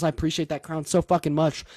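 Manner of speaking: ordinary talk, nothing marked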